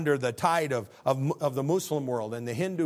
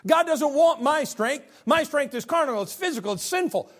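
high, loud preaching peak; preaching climax; 12 semitones higher, 5 dB louder than usual. Acted. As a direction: cry it out like a revival preacher.

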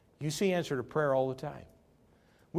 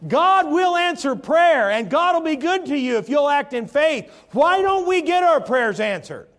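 preaching at full force; 11 semitones higher, 9 dB louder than usual. Real, not acted. Preacher urging the congregation to act in faith, loud and high. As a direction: drive the message home with full force.

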